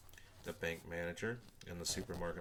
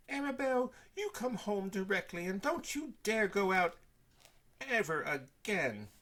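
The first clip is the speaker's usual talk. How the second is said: much higher, louder stern scolding; mother ordering Arabelle home; 13 semitones higher, 6 dB louder than usual. A narrator's reading. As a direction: tell her off firmly.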